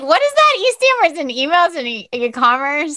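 high, loud puzzled question; baffled, high and loud; confused surprise. Retold or imitated. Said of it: "I was like".

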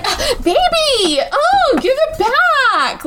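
high, slow, simpering plea with a laugh; sugary mock pleading through laughter; mock whiny girlfriend. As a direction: whine it like a clingy partner, poking fun. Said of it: "she's like".

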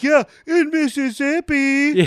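high drawn-out mock delivery; slow, high-pitched quoted punchline; hammed-up mimicry. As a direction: stretch it out high, hamming up the joke. Mimicking movie character's joke line.

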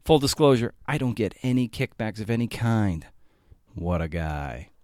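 ordinary delivery

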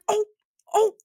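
high, alarmed oh oh oh; panicked realization. Retold or imitated. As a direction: gasp as the mistake sinks in. Realizing she hurt a student.

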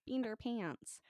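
high, exaggerated American accent; playful imitation of overdone American vowels; mock American accent. Host repeating the mimicry of her accent, mock American accent. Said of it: "That's how Sean does my accent".

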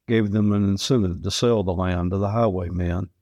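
usual speaking voice

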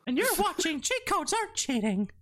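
very high cartoon mouse presenter; Mickey Mouse. Mouse character announcing the podcast, cartoon voice. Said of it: "I'm Mickey Mouse".

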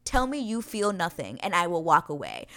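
ordinary talk

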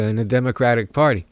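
ordinary talk, nothing marked

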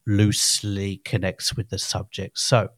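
normal talking voice

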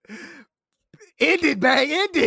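high, loud, laughing; loud laughing urge. Real, not acted. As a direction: shout it through laughter. Urging end as wives yell.

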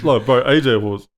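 ordinary talk, nothing marked

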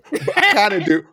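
laughing it out, pitch up. Real, not acted. Admitting to her co-host while laughing. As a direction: giggle through the admission.

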